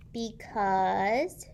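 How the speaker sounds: loud opening shout. A narrator's reading. The kids shouting back at George in chorus, loud. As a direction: call it out together. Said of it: "shouted Lindy".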